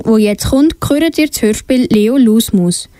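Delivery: ordinary delivery, nothing marked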